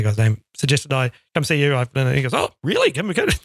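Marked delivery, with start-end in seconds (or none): 2.3-3.4 high, lifted mock-surprised question; impressed surprise. Second department head hearing the same name, impressed surprise. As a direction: imitate someone taken aback by a name-drop.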